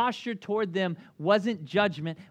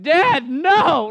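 loud, high horrified protest; children shouting no at their father; kids' horrified cry; 10 semitones higher, 12 dB louder than usual. Retold or imitated. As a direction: cry out in shock at dad. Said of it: "we were like".

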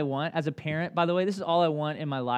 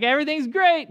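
much higher and louder, put-on brightness; fake cheerful; 11 semitones higher, 7 dB louder than usual. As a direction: sell a cheerful front you don't feel. Faking cheer at church.

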